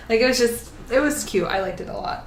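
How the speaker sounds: ordinary talk, nothing marked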